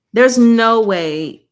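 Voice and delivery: slow and loud, drawn out; emphatic insistence. Real, not acted. Predicting the plot, slow and insistent. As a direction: stretch every word with force.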